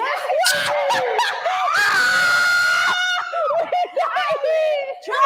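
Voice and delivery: high, loud shrieking laughter. Real, not acted. Cracking up at the answer, a high shrieking laugh.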